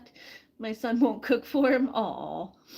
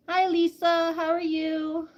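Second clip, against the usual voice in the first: ordinary talk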